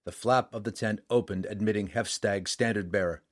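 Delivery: plain reading voice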